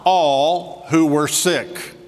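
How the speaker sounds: stretched very slow, each word laid down; drawn out slowly for weight; slow emphatic answer. Acted. Preacher landing the answer.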